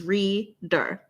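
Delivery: reader, slowed and split into two beats, REA-DER; syllables spelled out. Shown as a demonstration. Teacher splitting reader into syllables for viewers, slow and clear. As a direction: sound out reader slowly syllable by syllable. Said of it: "Reader is two syllables".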